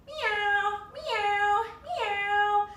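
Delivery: high, sweet kitty meows; cat meows. Shown as a demonstration. Yoga teacher meowing high for the kids in cat pose.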